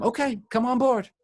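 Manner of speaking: high-pitched, rising frog invitation; eager agreement. Acted.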